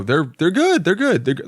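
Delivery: high-pitched, unconvincing reassurance; voice going up. Retold or imitated. Diner lying to the waitress about the mussels, voice going up. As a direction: pitch climbs as you insist, like a bad liar. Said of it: "when your vo-, voice goes up".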